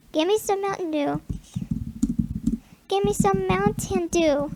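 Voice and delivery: ordinary talk, nothing marked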